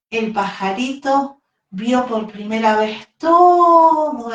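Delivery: put-on bright, lively voice reading to little children; storytelling for kids. A narrator's reading. Teacher telling kids the baby bird story, animated storyteller. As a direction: tell it like a bedtime tale for a class.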